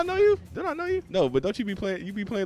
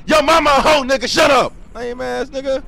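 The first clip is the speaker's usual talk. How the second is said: shouting; 13 semitones higher, 13 dB louder than usual. Real, not acted. Trash-talking again.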